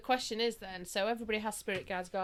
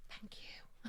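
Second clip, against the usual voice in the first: hushed, laughing whisper; tiny whispered thank-you; 10 dB quieter than usual. Retold or imitated. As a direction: whisper it as a mock-grateful aside. Retold thanks after the near crash.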